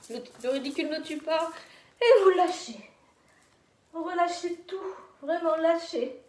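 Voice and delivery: high, loud encouragement; energetic coaching. Real, not acted. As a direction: push everyone to release. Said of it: "loud coaching to let go".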